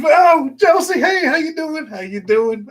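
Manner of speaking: high, loud cheerful greeting; excited greeting. Real, not acted. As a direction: greet a favourite viewer brightly. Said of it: "greeting viewer excitedly".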